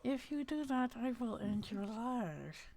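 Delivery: grit your teeth and threaten; through clenched teeth. Acted. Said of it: "speak through clenched teeth".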